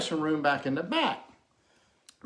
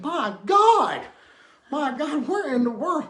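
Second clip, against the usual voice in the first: high, loud astonished yelp; shocked drunk; 8 semitones higher, 5 dB louder, slower than usual. Retold or imitated. Drunk man gawks at the leprechaun, astonished and high. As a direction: gasp it out amazed at the size.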